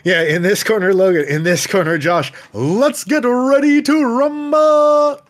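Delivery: booming ring announcer intro; boxing ring announcer. Pretend. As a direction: hype up the fighters like a boxing MC. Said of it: "do, like, the ring announcer voice".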